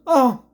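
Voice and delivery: loud high anguished "oh"; exaggerated cry of dismay; mock dismayed wail. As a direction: wail it like it physically hurts. Imitates commentator's dismayed outburst.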